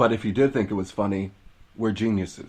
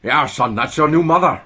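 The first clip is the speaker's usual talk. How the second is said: louder Scottish-tinged dad; louder mock Scottish father to son; 6 semitones higher, 9 dB louder than usual. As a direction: tell the son about his new mother, Scots dad. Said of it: "dad in Scottish accent".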